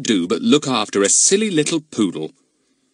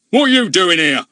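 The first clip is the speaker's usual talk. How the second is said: high, loud furious demand; angry demand; 10 semitones higher, 7 dB louder than usual. A narrator's reading. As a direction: shake the answer out of him. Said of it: "demanded Mister Goon angrily".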